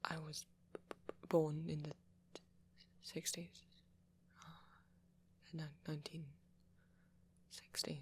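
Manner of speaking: soft, halting admission with a stammer; sheepish confession. Acted. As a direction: confess it quietly, stumbling over the words. Reluctantly confessing birth decade.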